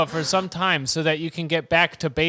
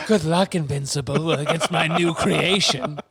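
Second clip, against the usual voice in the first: villain voice. Pretend. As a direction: gloat like a mad scientist. Playing villain Yakub taunting.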